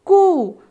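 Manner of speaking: teacher's emphatic result; firm blended syllable. Shown as a demonstration. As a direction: land कू clearly after the parts. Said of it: "हम इसे पढ़ेंगे कू".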